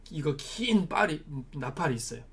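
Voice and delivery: ordinary talk, nothing marked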